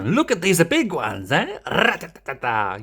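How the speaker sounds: put-on high Spanish accent with rolled trill; Rosita, Spanish accent. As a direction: exclaim in an exaggerated Spanish accent. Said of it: "maybe Espanol accenta".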